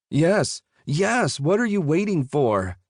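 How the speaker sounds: high, sharp impatient snapping; impatient snap. A narrator's reading. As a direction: snap at him, fed up. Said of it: "the man snapped".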